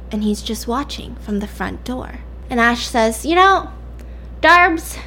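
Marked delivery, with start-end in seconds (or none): 3.3-5.0 high, lightly put-on friend voice; a hint of a sly friend; high Ash voice.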